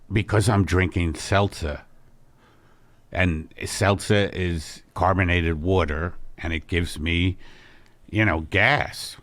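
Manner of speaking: a low, mellow middle-aged husband easing into news; Al's low voice. Acted. Husband calling his wife in to break big news, low and soft. Al explaining burp.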